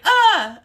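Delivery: loud, high startled yelp; big shocked "Ah!"; reenacted scream. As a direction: let out a short mock scream. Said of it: "I almost screamed".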